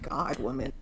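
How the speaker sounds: whispered, low "Oh my God, woman"; exasperated whisper. Real, not acted. Fed up with the nanny's paranoia, whispered. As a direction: breathe it out, fed up.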